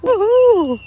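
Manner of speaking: a big happy cheer at the sea; shouting with delight at the beach; joyful whoop. Real, not acted.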